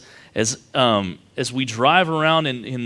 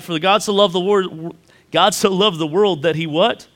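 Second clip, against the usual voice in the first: preaching build; 5 semitones higher, 4 dB louder than usual. Acted. Preacher leading the congregation through John three sixteen. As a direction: build the verse and drop to a hush on the answer.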